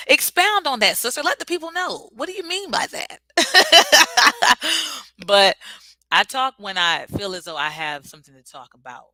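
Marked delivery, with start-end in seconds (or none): 0.1-3.2 high and playful; playful high-pitched teasing of a viewer; teasing hype. Real, not acted. 3.2-5.2 a loud high burst of laughter; big falling laugh. Real, not acted. Laughing after teasing.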